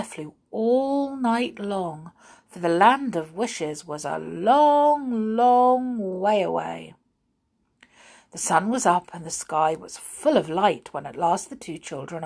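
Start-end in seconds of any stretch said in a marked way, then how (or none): none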